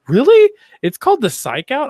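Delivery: Really? jumps high and loud in disbelief; amused, disbelieving double take at the name; real surprise. Real, not acted.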